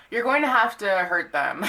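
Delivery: laughs through the harsh verdict; amused, half-laughing warning. Real, not acted. Reader laughing as she delivers the tough advice.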